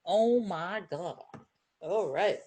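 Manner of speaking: usual speaking voice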